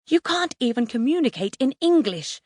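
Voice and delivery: high, exasperated outburst; flipping out. A narrator's reading. Mum losing it with the boys, exasperated. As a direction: lose your temper. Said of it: "Mum flips".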